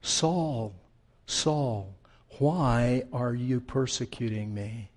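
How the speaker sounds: soft, low, intimate address; personal, intimate. Acted. God calling to Saul on the road, intimate. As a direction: speak it softly and low, as if privately. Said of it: "Personal, intimate".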